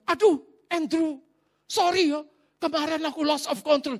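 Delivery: high-pitched imitation of a startled host apologizing; acted-out sheepish host; flustered apology.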